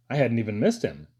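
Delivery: usual speaking voice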